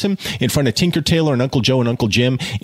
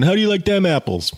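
mock Will Hunting taunt; 9 semitones higher, faster than usual. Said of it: "he's going".

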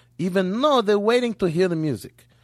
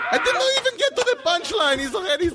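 laughing; 11 semitones higher than usual. Real, not acted. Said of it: "laughing at own joke".

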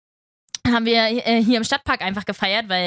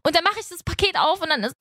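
ordinary talk

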